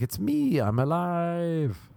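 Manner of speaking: high and slow, hammed-up triumph; mock rebirth joy. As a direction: squeal it like a newborn hero, drawn out. Waking up confused, reborn.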